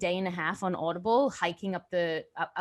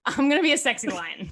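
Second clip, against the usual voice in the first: laughing; 5 semitones higher, 4 dB louder than usual. Pretend. Jokes about a sexy lion post.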